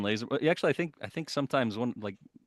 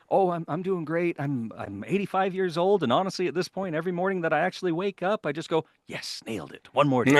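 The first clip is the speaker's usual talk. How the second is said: higher-pitched, animated retelling; upbeat quoted reply; 8 semitones higher, 4 dB louder than usual. Retold or imitated. Retelling an actor's joke about waking up at eighty-five, upbeat. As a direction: play it lively, ending on a louder punch.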